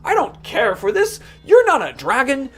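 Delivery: scoffing dogs. Acted. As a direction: scoff at the little dog's scratches. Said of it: "They're just like".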